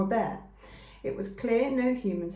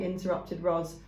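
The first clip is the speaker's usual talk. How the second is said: plain reading voice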